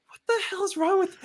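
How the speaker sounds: very high-pitched mock disbelief; imagined bewildered neighbour. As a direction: squeal it incredulously, without extra volume. Voices watching neighbour's disbelief.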